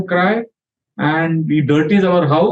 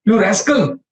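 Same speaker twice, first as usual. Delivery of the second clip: mild mock shout, pitched up; retold shout; 6 semitones higher than usual. Prabhupada scolds a disciple's son, mild retold shout. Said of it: "and shouted".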